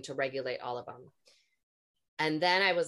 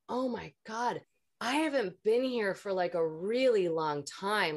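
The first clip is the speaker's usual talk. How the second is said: higher-pitched wondering exclamation; awestruck, raised voice easing down; retold amazement; 6 semitones higher, 3 dB louder than usual. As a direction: relive a sudden realization, pitch lifted. Retold moment of sudden realization.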